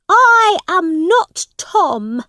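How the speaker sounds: high, loud, slow denial; indignant prince. A narrator's reading. Prince denying he is her son, indignant. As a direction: insist on it word by word, outraged.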